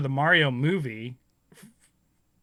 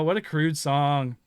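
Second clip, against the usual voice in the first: usual speaking voice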